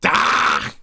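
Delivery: harsh, rasping cry of frustration; frustrated groan. Real, not acted. Gamer forced to restart the day, frustrated rasp. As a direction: let out a rough "ah" of annoyance.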